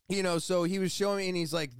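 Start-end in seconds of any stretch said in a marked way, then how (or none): none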